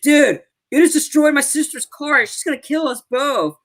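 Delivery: high-pitched mock outrage; imitated quarrel. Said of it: "almost like a fight almost broke out".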